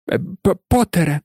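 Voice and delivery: high, stuttering professor; jittery, halting greeting; nervous stammer. A narrator's reading. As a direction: stumble quickly over the first sounds. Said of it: "затинаючись, вимовив професор Квірел".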